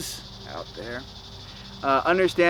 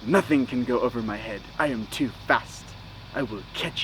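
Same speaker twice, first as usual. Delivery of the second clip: lower, softer mock Drax voice; a lower, quieter take on Drax; imitating Drax; 6 semitones lower, 7 dB quieter than usual. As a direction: play it as a literal-minded hulk, low and soft. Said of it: "Drax, Batista was all like".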